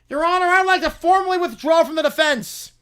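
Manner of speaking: loud, high formal announcement; dramatic declaration. Acted. Defense lawyer announcing his withdrawal to the judge, loud. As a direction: grandstand to the judge.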